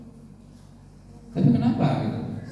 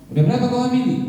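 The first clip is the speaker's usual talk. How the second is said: brash youth taunt; 7 semitones higher, much faster than usual. Retold or imitated. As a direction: needle a friend brashly. Youth bragging voiced.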